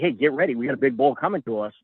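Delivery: usual speaking voice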